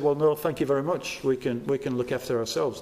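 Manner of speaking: low, quick self-sufficient refusal; polite brush-off. Acted. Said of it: "they said".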